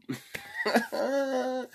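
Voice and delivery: high-pitched drawn-out laugh. Real, not acted. Speaker amused by asking listeners to let him know, laughing. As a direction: let out a long, high giggle.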